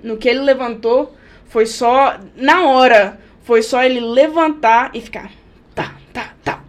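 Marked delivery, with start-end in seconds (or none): 5.7-6.6 light unvoiced tapping sounds for a kid jumping; soft patter like small feet bouncing; quiet mock tapping; tapping noise.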